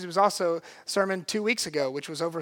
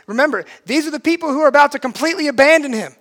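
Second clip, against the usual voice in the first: high, loud, fast preacher's urgency; impassioned preaching; 7 semitones higher, 9 dB louder, much faster than usual. Acted. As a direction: preach it urgently, voice raised and racing. Preaches at full force.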